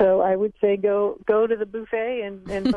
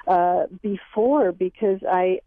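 ordinary talk, nothing marked

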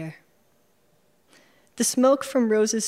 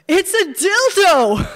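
much higher and louder, slow, laughing through it; laughing announcement; 12 semitones higher, 10 dB louder, slower than usual. Acted. Narrator announcing the find to everyone, laughing shout. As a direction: blurt it out cracking up.

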